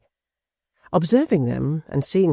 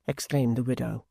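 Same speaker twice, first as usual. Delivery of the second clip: ordinary delivery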